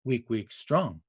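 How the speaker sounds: "weak, weak, strong" with the weight on "strong"; two light "weak"s, then lean on "strong"; weak-weak-strong stress. Shown as a demonstration. Naming the anapest's beats, weak-weak-strong stress. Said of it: "One of them is the Anapest".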